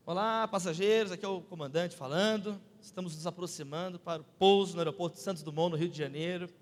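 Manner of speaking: low-pitched captain addressing passengers; airline captain announcement. Retold or imitated. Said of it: "o piloto falou assim".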